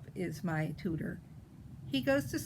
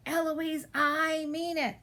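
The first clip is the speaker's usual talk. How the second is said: high, drawn-out mock shout; mimicking shout; 6 semitones higher, slower than usual. A narrator's reading. Eloise shouting her tutor's words back, high mimicking shout. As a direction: parrot the shout in a raised pitch. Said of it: "And then I shout".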